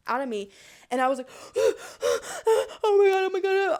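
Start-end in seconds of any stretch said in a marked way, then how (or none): 1.6-3.8 high, loud gasping cries of panic; frantic panic. Retold or imitated. Choker just after the food comes out, frantic. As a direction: act out frantically gasping for air and crying out. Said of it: "frantic, like, chaotic state".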